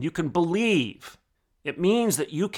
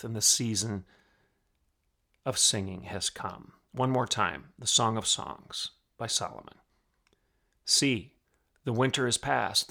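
soft, low, unhurried reading; scripture reading; 6 semitones lower, 5 dB quieter than usual. Acted. Host reading Solomon's song to listeners, soft and low. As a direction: read it like a gentle poem. Reads Song of Songs softly.

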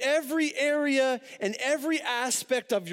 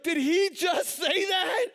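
high, loud, stretched-out gasp of disbelief; retelling a stunned outburst; shocked disbelief; 7 semitones higher, 3 dB louder, slower than usual. Retold or imitated. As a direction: react like you can't believe what he said. Shocked at ride operator's remark.

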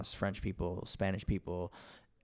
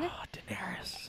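breathy whisper; 12 semitones higher, 8 dB quieter than usual. Real, not acted. Guest reacts to Ice Queen card.

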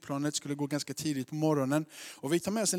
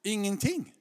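raised, dismissive comeback; breezy protest; 6 semitones higher, 6 dB louder than usual. Retold or imitated. Husband answering his wife's warning, breezy protest. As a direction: play down the danger to a worried wife. Acted quarrel with his wife.

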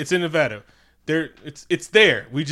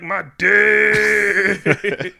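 mock anguished wail; 6 semitones higher, 8 dB louder than usual. Ben wailing comic line.

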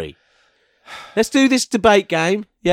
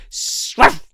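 hissed, voiceless bark; half hiss, half dog bark; snake dog voice; 3 semitones higher than usual. Acted. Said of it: "I'll do the voice for Snake Dog".

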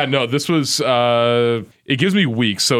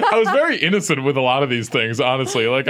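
normal talking voice

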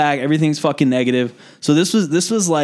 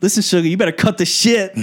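sassy Southern nurse, pitched up; higher-voiced comic Southern nurse; southern accent; 6 semitones higher than usual. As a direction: scold with a Southern twang. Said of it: "southern accent".

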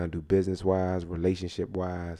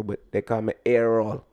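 put-on Jamaican accent; 3 dB louder than usual. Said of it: "My name Azel".

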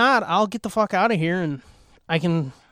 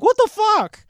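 much higher, louder startled cry; shocked outburst; 7 dB louder than usual. Retold or imitated. Walking in on an alien scene unprepared, a shocked cry. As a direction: caught completely off guard. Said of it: "walked into it and was like".

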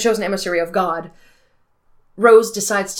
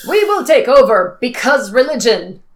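loud, higher villain declaration; unhinged menace; 4 semitones higher, 8 dB louder than usual. Retold or imitated. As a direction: ham up the evil plan. Mocking villain's plan.

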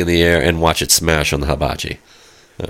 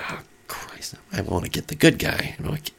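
hushed whisper under the breath; whispered aside; 10 dB quieter than usual. Retold or imitated. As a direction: whisper it so the chef can't hear. Inner thought in the restaurant.

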